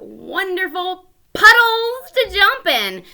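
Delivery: loud, high and delighted; excited. Shown as a demonstration. Teacher exclaiming to kids about jumping in puddles. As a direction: burst out with joy about the puddles.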